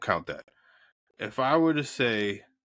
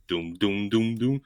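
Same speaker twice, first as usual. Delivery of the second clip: sung-out thudding bass notes; doom out the band's opening beat; imitated band intro; 4 semitones lower, slower than usual. Recounting how the band started the song, imitated band intro. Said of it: "you know, the band starts".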